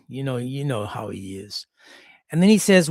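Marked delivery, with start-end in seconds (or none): 0.1-1.7 hushed, unvoiced grumble to a friend; whispered aside. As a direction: whisper it so he can't hear. Girls muttering while he speaks.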